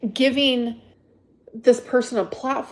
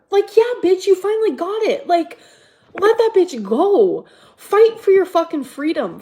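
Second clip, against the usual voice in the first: high-pitched excited rant; 7 semitones higher, 4 dB louder than usual. Real, not acted. Ranting about Stella finally getting it.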